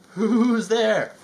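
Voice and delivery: high nervous "who's there"; frightened call. Acted. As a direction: call out, scared stiff. Scared Rabbit calls into mist.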